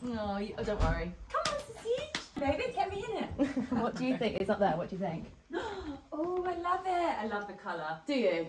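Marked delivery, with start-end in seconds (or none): 1.3-3.3 much higher cooing voice; high puppy-talk coax. Real, not acted. Talking to the dog. 5.5-7.2 delighted gasp. Real, not acted. Seeing bridesmaid dress.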